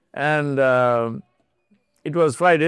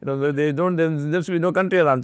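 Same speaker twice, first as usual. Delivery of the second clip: ordinary delivery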